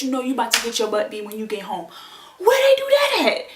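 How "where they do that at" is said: very high, loud squeal of disbelief; shrieked incredulous question; comic outrage. Real, not acted. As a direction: exclaim it in laughing indignation. Outraged at principal's office warning.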